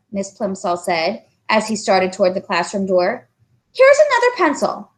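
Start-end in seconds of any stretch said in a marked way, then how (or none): 3.7-4.9 kindly teacher. Acted. Teacher handing pencil.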